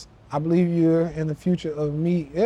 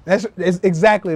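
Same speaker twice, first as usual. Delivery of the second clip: normal talking voice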